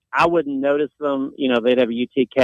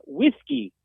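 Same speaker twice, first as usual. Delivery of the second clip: imitating locals; 8 semitones higher, 5 dB quieter than usual. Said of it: "they would reply".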